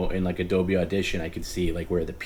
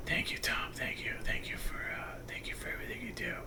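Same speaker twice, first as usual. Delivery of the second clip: soft whispered thanks; playful whispered sleep talk; mock sleep whisper; 11 semitones higher, 15 dB quieter than usual. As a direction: whisper it like a dreaming friend. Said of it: "I was waiting to hear me".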